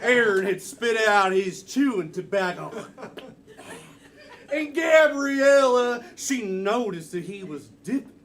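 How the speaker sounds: loud, high twangy cowboy storyteller; cowboy Western. Acted. Improviser narrating Aaron's chewing tobacco, cowboy Western style. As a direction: spin it like an old Western yarn. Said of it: "Ooh, cowboy Western".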